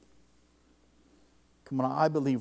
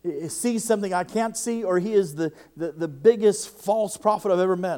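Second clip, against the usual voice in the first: loud, high accusation; man voicing his anger at the preacher; retold heated outburst; 7 semitones higher, 8 dB louder than usual. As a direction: shout the false prophet charge. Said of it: "And I said".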